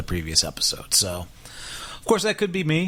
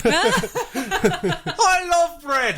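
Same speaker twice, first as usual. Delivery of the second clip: Oprah impression; 12 semitones higher, much slower than usual. Imagined deleted scene, Oprah delighted by stolen bread. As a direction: gush over the bread like a talk show host. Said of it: "it's Oprah".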